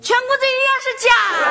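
high, fast insistent shout; yelling out. Acted. Child pressing for a refund, high quick yelling. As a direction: yell it out to force his hand. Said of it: "你不要喊了".

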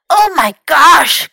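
loud, high, drawn-out cry of dismay; alarmed cry. A narrator's reading. Fox finds Louis after the fall, alarmed cry. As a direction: exclaim in shock, slow and loud. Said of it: "cried Fox".

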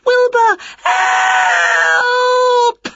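very high, loud cry for help; panicked shout. A narrator's reading. Winnie chased by the dog, calling her cat, panicked shout. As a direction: shriek it, stretching out the last word. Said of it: "shouted Winnie".

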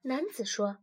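usual speaking voice

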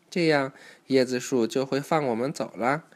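ordinary delivery, nothing marked

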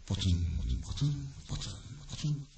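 soft, low dripping sound; raindrops imitated. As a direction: drop each drip quietly into the silence. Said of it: "雨の降るような音がした".

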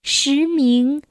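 very slow, measured classical poem reading; poetry recitation. Acted. Reader reciting a Tang poem to a child, slow declamation. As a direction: draw out each syllable, pause after every phrase.

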